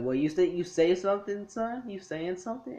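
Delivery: lightly voiced Beerus daring him to repeat it; mock challenge. Beerus confronting Whis.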